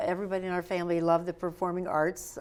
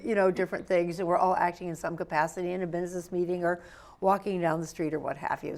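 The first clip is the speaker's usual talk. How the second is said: ordinary talk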